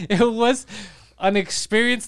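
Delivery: ordinary delivery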